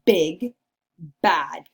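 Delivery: Big, bad with a loud, punched B; stress the B. Shown as a demonstration. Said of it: "starts with the B sound".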